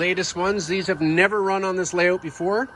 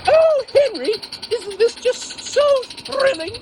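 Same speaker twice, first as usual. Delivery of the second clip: much higher and louder, slow and thrilled; Marge; 4 dB louder, slower than usual. Acted. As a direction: gush like an excited old lady.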